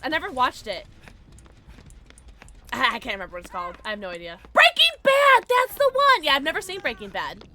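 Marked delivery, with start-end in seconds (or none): 4.5-6.2 high loud eureka shout; excited recall. Real, not acted. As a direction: shout it out triumphantly. Triumphant recall.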